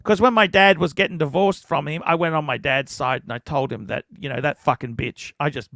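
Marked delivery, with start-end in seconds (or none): none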